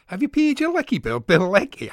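high, loud mock Scottish accent; a hammed-up Scottish teacher calling the register. Co-host showing how he played with the name, in a high loud Scottish accent. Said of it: "I started doing it in all different Scottish accents".

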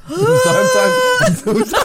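high, loud laughing sorry; squealing with laughter, sorry; laughing apology. Real, not acted. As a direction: cackle out the apology. Laughing mock apology after joke.

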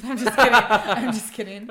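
loud laughing take-back. Real, not acted. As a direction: burst out laughing through the words. Laughing after teasing Chris.

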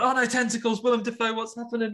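high mock panic, words tumbling; panicked high voice. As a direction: squeal it like sudden horror. Panicked inner thought, much higher.

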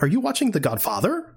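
high-pitched mimicry of a woman's question; high, put-on female character voice; imitating her question. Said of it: "The way she says it".

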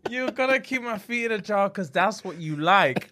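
laughing through the words; after laughing hard. Real, not acted. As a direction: still wheezing with laughter. Repeating joke through laughter.

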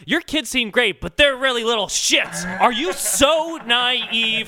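high mocking lecture to mothers; preachy know-it-all voice. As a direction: preach it like a condescending prophet. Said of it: "going up to mothers being like".

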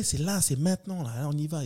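ordinary talk, nothing marked

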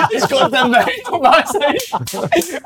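high, slowed down by laughter; laughing while talking. Real, not acted. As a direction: struggle to get it out through laughs. Teller cracks up at own story.

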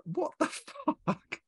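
high, drawn-out retold outburst; exasperated disbelief. Customer retelling a small parcel charge, exasperated.

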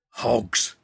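ordinary delivery, nothing marked

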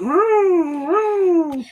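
high, loud mock toy gun "vroom". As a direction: make the fifties toy gun noise. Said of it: "his gun was making".

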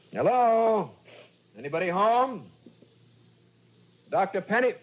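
high, loud call into an empty house. Acted. Investigator calls into the empty house, loud and searching. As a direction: call through the rooms to find him.

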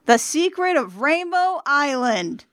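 high, slow, drawn-out awe; gasped wonder. A narrator's reading. Reader voices the finder naming the treasure, awed gasp. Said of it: "you gasp".

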